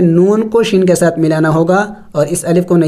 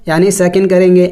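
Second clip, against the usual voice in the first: usual speaking voice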